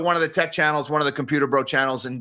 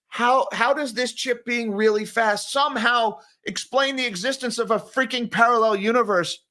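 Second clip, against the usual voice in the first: baffled disbelief; 6 semitones higher than usual. Retold or imitated. Host retelling his question to a colleague, baffled and high-pitched.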